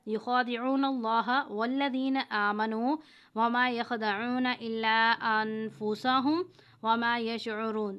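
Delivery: chanted Quran recitation; Quranic recitation. Shown as a demonstration. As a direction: recite the verse with tajweed. Recites Quran verse as example.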